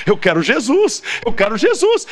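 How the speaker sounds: playful imitation of a crying girl; mock tearful plea. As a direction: imitate a weeping convert begging. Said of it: "Ela começou a chorar".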